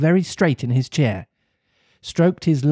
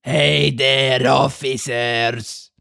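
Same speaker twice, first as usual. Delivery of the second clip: slow, booming puppet-master call; slow booming villain calling his guards; Fire Eater calling; much slower than usual. Acted. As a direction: summon the guards like a gruff showman. Fire Eater calls his officers.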